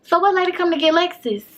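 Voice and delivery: the auntie asks again; auntie's question. Retold or imitated. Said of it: "I hear my auntie again say".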